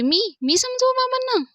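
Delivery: high-pitched, startled questioning; shocked disbelief. Acted. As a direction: voice shoots up, aghast at the request. वहिनीला बाबांना समजवायला सांगितल्यावर. (अवाक होऊन, चढ्या आवाजात)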